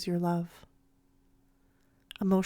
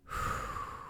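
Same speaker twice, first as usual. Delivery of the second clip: soft, audible breath out; calming exhale; 9 dB quieter than usual. Acted. Coach steadying a panicked player, calming exhale. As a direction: blow out a long, steady breath.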